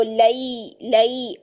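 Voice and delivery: "लई, लई" gliding from a into i; careful diphthong on each "लई"; glide into ya. Shown as a demonstration. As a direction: bend the a of "लई" clearly toward i. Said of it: "या साकिन से पहले जबर है तो".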